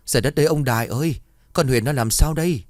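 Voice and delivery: a mother shrieking for her husband, rushed and high; panicked wail. Acted. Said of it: "bà gào toáng cả lên".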